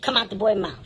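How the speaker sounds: ordinary talk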